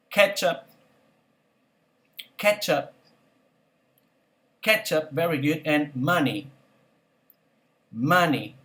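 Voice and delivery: each word said alone, then a pause to repeat; vocabulary drill. Shown as a demonstration. Teacher drills vocabulary for students to repeat. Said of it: "teacher drilling vocabulary".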